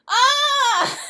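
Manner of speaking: loud high laughing yelp; dad's startled laughing cry. Retold or imitated. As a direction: burst out as it finally clicks. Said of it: "And he goes".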